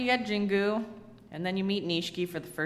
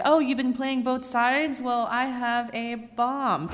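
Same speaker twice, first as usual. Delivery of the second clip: mocking villain line; 6 semitones higher, slower than usual. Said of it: "And it's like".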